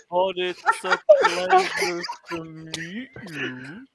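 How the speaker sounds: slow, lightly voiced tree. Tree scolding the visitors in a slow voice. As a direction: let each word take its time. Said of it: "This isn't my real voice either".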